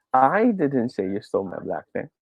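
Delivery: single out "I", then ease off; stress on I. Shown as a demonstration. Teacher repeating the demonstration for the class, stress on "I". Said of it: "is in the word I".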